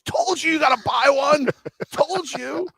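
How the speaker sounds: very high, loud heckle; mock gloating shout. Guy in the corner heckles his colleague, mock shout. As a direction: holler it like a vindicated coworker. Imitated coworker calling from the corner.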